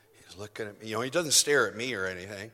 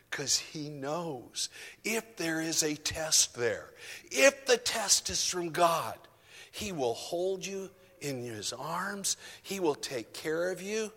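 tender; 6 semitones higher, slower than usual. Real, not acted. Tender reassurance about God.

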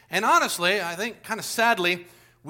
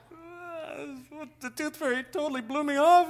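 higher, put-on voice of a let-down boy; disappointed kid; 7 semitones higher, 3 dB quieter than usual. Boy finding his tooth still there, higher and let down. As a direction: sound like a kid cheated by the fairy.

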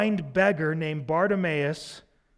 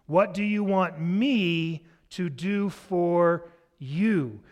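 slow, deliberate question; much slower than usual. Retold or imitated. Jesus's question, said slowly.

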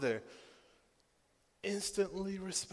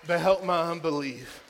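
impassioned preaching; 3 semitones higher, 9 dB louder than usual. Acted. Pastor preaching his own prayer for his daughter, loud. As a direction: cry it out like a prayer.

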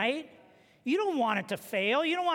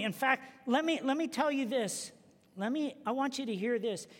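usual speaking voice

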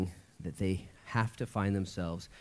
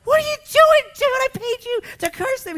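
high, loud outraged squawk; shrill cartoon outrage, much higher and louder; mad Balak; 13 dB louder than usual. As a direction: play the furious king who paid for a curse. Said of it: "He's all mad about it".

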